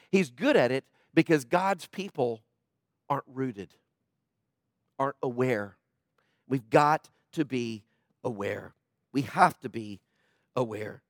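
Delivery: low, hushed and halting; hushed, solemn preacher's warning; grave warning. Real, not acted. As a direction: let it sink very softly, with heavy pauses. Preacher gravely warns the congregation.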